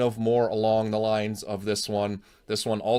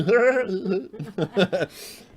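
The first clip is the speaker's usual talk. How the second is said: high laugh; 11 semitones higher, 5 dB louder than usual. Real, not acted. Laughs after impression again.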